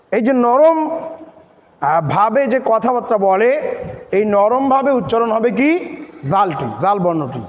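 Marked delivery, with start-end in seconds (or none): none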